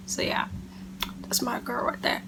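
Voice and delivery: whispered secret about her friend; whisper aside. Real, not acted. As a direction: lean in and whisper it to the camera. Quiet aside to the camera.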